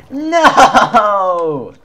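long, high, loud "no" falling away at the end; drawn-out cry of dismay; dismayed yell. Real, not acted. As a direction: wail it out in defeat. Dismayed cry as play goes wrong.

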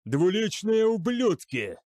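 high, drawn-out furious cry; angry shout. A narrator's reading. Commander cursing traitors, shouting angrily. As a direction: curse them at a shout. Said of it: "закричал Намаджира".

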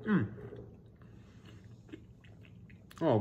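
chewing while talking; muffled with food; mouth full. Real, not acted. Seen 1: Bites burger, eats a dropped piece, then chews with mouth mostly closed.

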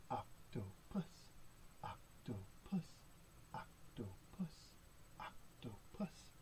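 slow, soft OC-TO-PUS in separate beats; hushed syllables. Pretend. Reader prompts children to say octopus, slow hushed chant.

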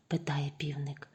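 ordinary delivery, nothing marked

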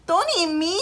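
high, loud little-goat plea; small goat pleading. A narrator's reading. Reynaldo begs the chupacabra, high small voice. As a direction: beg for your life. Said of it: "Reynaldo pleaded".

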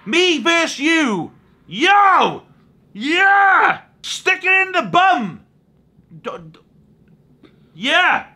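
high, loud hype-man yelling; hyped callout. Acted. Second take of the voice message to Logan, hyped shouting. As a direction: pump yourself up and yell. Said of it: "I'm just preparing myself".

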